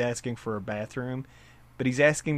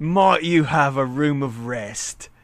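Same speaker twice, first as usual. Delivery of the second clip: slow, deliberate put-on voice of an oddly formal customer; mock-polite stranger, slow and drawn out; affected polite stranger; 4 dB louder, slower than usual. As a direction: draw out the courteous request like a stranger imitated in an anecdote. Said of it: "this guy comes in, and he goes".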